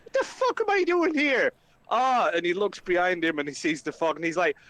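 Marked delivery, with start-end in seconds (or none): 0.0-2.3 high-pitched outraged retort; Flynn, indignant. Acted. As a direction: throw it back, incredulous and cross. Flynn snaps back, indignant at remark.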